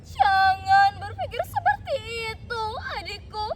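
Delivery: high, loud crying plea; emotional older sister soothing a sibling; tearful reassurance. A narrator's reading. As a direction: console through tears. Sister comforts brother while hugging him.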